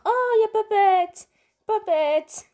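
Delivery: high, loud delighted cry; excited call-out. Real, not acted. Player spotting a favorite game character, excited shout.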